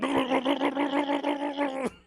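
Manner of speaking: high, loud rattling blubber; imitated seizure noise. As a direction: judder the sound out steadily. Acting out a seizure again.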